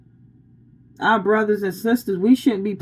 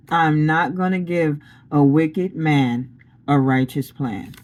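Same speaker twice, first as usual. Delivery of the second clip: slow and lower, spaced out phrase by phrase; measured pronouncement; 5 semitones lower than usual. Retold or imitated. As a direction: repeat it deliberately, sinking low on the last word. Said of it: "That's the way he just said it".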